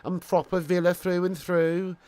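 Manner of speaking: slow, lightly mimicked Brummie football fan; Birmingham accent. Streamer imitating the Villa player for viewers, Brummie accent. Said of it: "He sounds like this".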